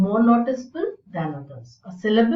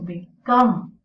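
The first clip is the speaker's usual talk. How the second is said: become with COME loud and drawn out, BE weak; be-COME, slowly; stress on the root; 5 dB louder than usual. Shown as a demonstration. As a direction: punch the second syllable of become. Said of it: "This also is weak".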